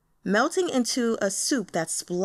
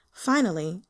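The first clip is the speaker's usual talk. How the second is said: ordinary delivery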